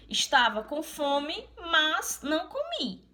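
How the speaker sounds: slow, rising on "mas não comi"; contrast. Shown as a demonstration. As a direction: show the contradiction slowly. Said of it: "A palavra mas significa contradição". (contraste marcado em "mas")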